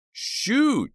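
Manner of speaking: shrill high scream. Acted. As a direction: scream the one word. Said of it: "Jade and Dina screamed in unison".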